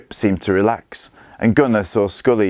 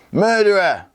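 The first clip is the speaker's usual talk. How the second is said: put-on furious boy yelling at his father's killer; yelled accusation; 13 semitones higher than usual. A narrator's reading. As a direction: scream the word at him. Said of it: "yelled Gunnar".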